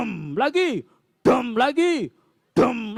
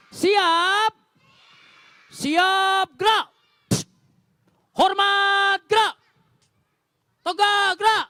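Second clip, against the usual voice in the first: drill commands; 10 semitones higher than usual. Pretend. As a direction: shout like a playful drill leader. Drill commands to mouse troops.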